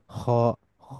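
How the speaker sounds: খ with rounded mouth and full vowel; heavy back "kh" on খ, toward "khaw". Shown as a demonstration. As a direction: say খ as Arabic tafkhim. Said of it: "উচ্চারণটা হচ্ছে heavy".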